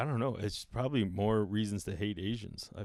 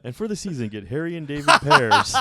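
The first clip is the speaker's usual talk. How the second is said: mock ad read; 4 semitones higher, 8 dB louder than usual.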